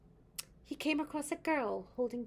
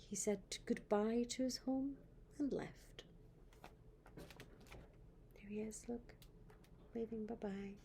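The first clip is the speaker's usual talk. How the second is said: hushed sad farewell; whispering; 8 dB quieter than usual. A narrator's reading. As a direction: whisper it gently. Sad farewell read softly.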